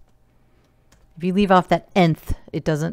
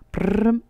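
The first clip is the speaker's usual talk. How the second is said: rolled-tongue drum roll, pitched up; mock drum roll; 4 semitones higher than usual. Teacher filling the suspense before results, mock drum roll. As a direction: trill a makeshift drum roll. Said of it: "that's my drum roll".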